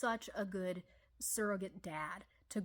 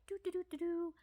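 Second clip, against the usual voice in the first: sing-song doo doo; 10 semitones higher than usual. Retold or imitated. Imitating rooster wandering off.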